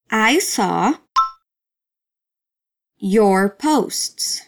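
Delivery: each word split off by a long pause; slow word-by-word teaching drill. Shown as a demonstration. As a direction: say it one slow word at a time for the class.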